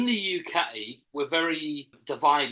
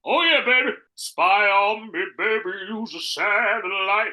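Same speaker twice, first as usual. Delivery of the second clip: loud, slow belting crooner impression; 3 semitones higher, 7 dB louder, slower than usual. Performing on a co-host's request, loud crooner impression. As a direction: belt it out with drawn-out bravado. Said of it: "Do your [name]".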